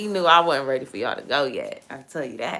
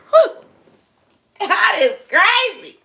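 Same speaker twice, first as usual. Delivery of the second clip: high, loud and slow, in awe; amazed exclaim; 14 semitones higher, 7 dB louder, slower than usual. Retold or imitated. Telling viewers what she said to Charles, a high slow amazed cry. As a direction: stretch it out like it floored you. Retold reaction to text.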